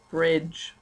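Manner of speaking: ordinary talk, nothing marked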